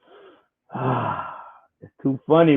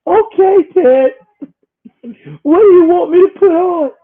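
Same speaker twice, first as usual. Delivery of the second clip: mock timid wife; 12 semitones higher, 6 dB louder than usual. Mocking Whoopi as a timid wife, high and pleading. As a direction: play the meek wife asking her man. Voicing Whoopi asking Ted.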